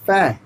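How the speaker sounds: फै said with a short open æ vowel, not फा; teacher models फै with an æ vowel; फै demo. Shown as a demonstration. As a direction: say फै as in fashion, with a flat æ sound. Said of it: "फा नहीं".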